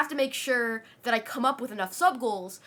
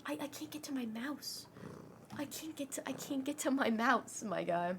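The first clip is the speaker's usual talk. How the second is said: ordinary delivery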